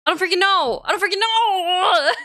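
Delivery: near-crying frustrated outburst; exasperated yell. Real, not acted. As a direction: throw your hands up and yell it. Frustrated repetition.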